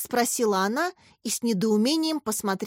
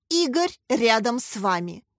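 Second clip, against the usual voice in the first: usual speaking voice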